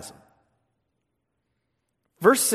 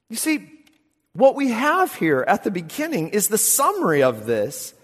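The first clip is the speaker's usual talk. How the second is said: ordinary delivery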